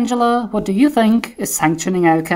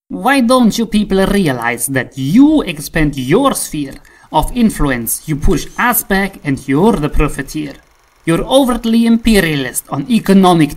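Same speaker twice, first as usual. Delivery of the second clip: loud, hammering complaint; loud angry lecture; heated rant; 5 dB louder than usual. Acted. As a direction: shout him down, louder each line. Putin rant builds.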